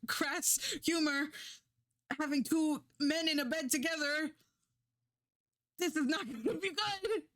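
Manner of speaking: high-pitched, apprehensive retelling; mock dread. Viewer dreading an old cartoon's crass joke, mock dread. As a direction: brace for the worst, voice climbing high.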